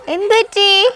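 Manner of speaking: high-pitched, drawn-out concern. Real, not acted. Interviewer to the crying boy, concerned. Reacting to Shiju starting to cry.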